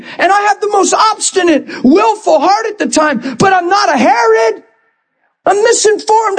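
high, pleading pulpit fervor; full-force preaching. Real, not acted. Pastor defending his lost younger self, fervent high preaching. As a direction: plead the case high and insistent.

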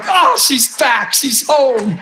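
high, drawn-out joyful cry; acted elation. Minister spotting healed woman dancing, elated cry. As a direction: exclaim it slowly in high delight.

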